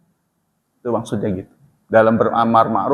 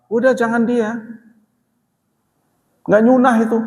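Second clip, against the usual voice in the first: higher, dismissive brush-off; dismissive retort; 9 semitones higher than usual. Retold or imitated. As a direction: shove the other preacher aside. Someone barging in, dismissing preacher.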